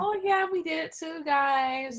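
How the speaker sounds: high-pitched imitation of her chiming in; mock girl-talk. Co-host imitating her with the girls, high mock chiming-in. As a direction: mimic her up high, playing along with the girls.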